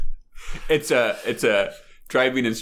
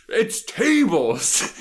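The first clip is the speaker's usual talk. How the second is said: high, very slow, matter-of-fact; deadpan instructor; 9 semitones higher, much slower than usual. Retold or imitated. Retelling the instructor, high and very slow. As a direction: state it as obvious. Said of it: "he goes".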